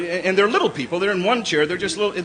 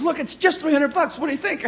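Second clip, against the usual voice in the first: lightly voiced wife; 9 semitones higher than usual. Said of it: "My wife goes".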